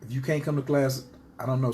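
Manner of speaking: ordinary delivery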